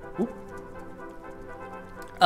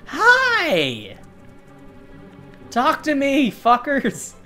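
excited shout; 11 semitones higher, much slower than usual. Real, not acted. Greeting the game's bees.

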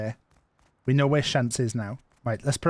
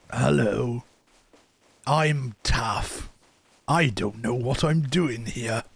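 slow, put-on old man; 3 semitones lower, much slower than usual. Shown as a demonstration. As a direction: talk like a slightly lost old man. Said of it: "shall I be an old man?".

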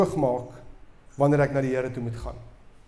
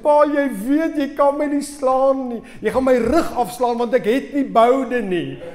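high, loud, panicked begging, half laughing; a boy's last-ditch wail to dodge the belt; desperate plea; 9 semitones higher, 7 dB louder than usual. Retold or imitated. Boy to his father as last resort, high loud mock panic.

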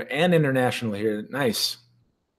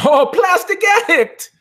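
high, loud, drawn-out holler; excited shout-out; 6 dB louder, slower than usual. Pretend. Host remembering a missing guest, high excited shout. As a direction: shout the name as if suddenly remembering it.

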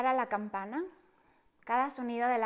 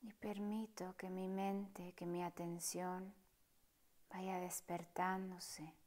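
soft, partly whispered meditation voice; hushed calm guide inviting stillness; guided meditation; 2 semitones lower, 10 dB quieter than usual. Acted. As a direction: guide them gently through the breath, unhurried. Leads a breathing meditation.

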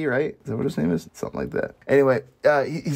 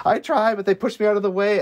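higher-pitched, mildly imitated protest; retold pleading protest; 8 semitones higher, 4 dB louder than usual. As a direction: plead the excuse in a raised pitch. Retold excuses of the paralyzed man.

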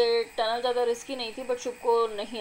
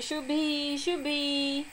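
calling Shubhi from a distance, pitch a bit higher; calling out a name; 4 semitones higher, much slower than usual. Real, not acted. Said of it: "मैं यहाँ पे आवाज़ लगा रही हूँ".

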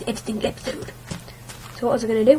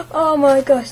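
surprised gasp; 5 semitones higher, 7 dB louder than usual. Real, not acted. Gasps after lucky kill.

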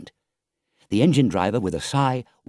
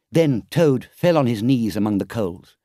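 ordinary delivery, nothing marked

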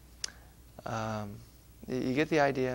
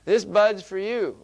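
mock beer ad; 4 semitones higher, 8 dB louder than usual. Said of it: "What if Jesus says".

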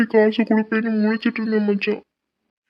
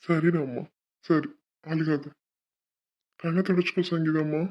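soothing comfort; 5 semitones lower, 4 dB quieter than usual. Acted. Brother soothes crying sister.

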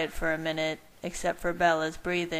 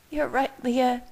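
high-pitched, acted tearful voice; sobbing; 7 semitones higher, 3 dB louder than usual. A narrator's reading. Bella admits Leah is right, in an acted sob. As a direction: give in as if sobbing. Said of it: "Bella sobbed".

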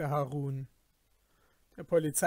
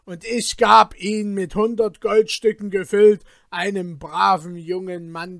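loud, higher, hammed-up outrage; feigned astonishment; 5 semitones higher, 16 dB louder than usual. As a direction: fake shock and roar at the merchant. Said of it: "rief mit verstelltem Erstaunen".

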